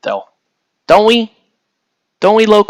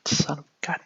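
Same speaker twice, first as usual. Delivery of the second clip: breathy whisper, swallowing the curse; 13 dB quieter than usual. Real, not acted. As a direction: hush the swear under your breath. Softened curse right after.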